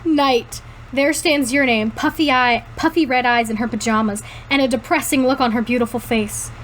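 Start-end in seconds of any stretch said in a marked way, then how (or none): none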